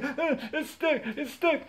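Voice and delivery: put-on high, loud squealing child in a panic; mock panicked screaming. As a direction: scream it like a stuck kid, hammed-up. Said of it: "I started screaming".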